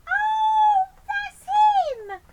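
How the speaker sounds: very high, loud cry of joy; overjoyed Betty. Acted. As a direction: squeal with delight.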